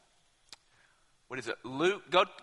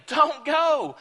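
much higher, loud pleading cry; begging plea; 10 semitones higher, 7 dB louder than usual. Retold or imitated. Friends begging Paul to stay, high and pleading. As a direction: beg him not to leave.

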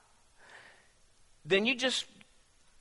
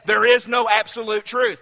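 louder, stress on is; emphatic quote; 3 semitones higher, 8 dB louder than usual. Retold or imitated. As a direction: hammer the claim home. Re-quoting same statement.

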